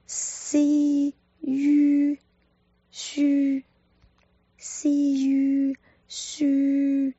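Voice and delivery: 西유 drawn very slowly, lips rounding at the end; slow spelled-out syllables. Shown as a demonstration. Teacher spelling out 书 for learners, very slow. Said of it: "嘴呢要呈圆形".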